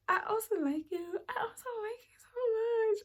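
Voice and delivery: high, crying, half-whispered confession; drunk tearful gush. Retold or imitated. Confessing to her new man, high and teary. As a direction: gush it like a tipsy teary girl. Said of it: "I'm drunk, guys".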